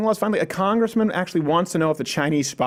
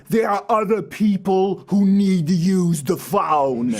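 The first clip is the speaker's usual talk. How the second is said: slow, deadpan nasal-free droning impression; Dumb and Dumber phone guy; slower than usual. Mocking Cuomo by imitating the Dumb and Dumber guy slowly. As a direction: drag it out like the annoyed phone guy. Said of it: "sound like the guy in Dumb and Dumber who's like".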